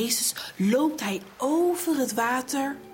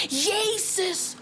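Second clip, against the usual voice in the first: Peter calls to Jesus across the storm, shouting; storyteller's shout; shouting over the storm; 7 semitones higher than usual. A narrator's reading. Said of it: "Petrus schreeuwt tegen de storm in".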